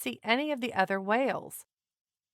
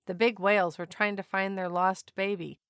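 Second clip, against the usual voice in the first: plain reading voice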